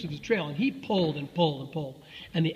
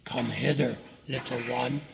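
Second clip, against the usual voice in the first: low, soft crocodile croon; luring crocodile; 6 semitones lower, 4 dB quieter than usual. A narrator's reading. Crocodile coaxing the elephant's child closer, low and luring. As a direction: beckon softly, deep below your own voice.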